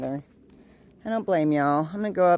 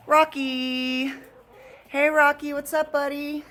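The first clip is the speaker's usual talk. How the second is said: high pet-talk voice; 8 semitones higher, 3 dB louder than usual. Real, not acted. Greeting the dog.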